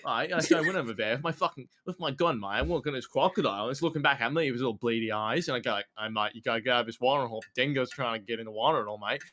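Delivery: hammed-up Aussie outback yarn; put-on Aussie bushman storyteller accent; Australian accent. Shown as a demonstration. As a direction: spin a tall tale in broad Australian. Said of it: "I could do a pretty good Australian accent".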